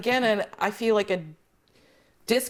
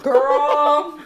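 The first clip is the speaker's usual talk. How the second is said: put-on loud, drawn-out, higher "girl"; retold exclamation; 5 semitones higher, 7 dB louder than usual. Guest retelling comeback to friend denying her accent, loudly disbelieving. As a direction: call her out with a big, teasing cry.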